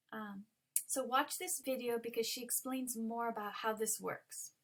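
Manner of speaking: normal talking voice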